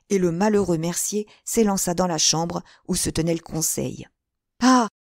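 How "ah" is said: loud, high, slow plea; desperate appeal, louder and higher; crying out. A narrator's reading. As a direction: cry out to them for help. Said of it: "s'écria-t-il".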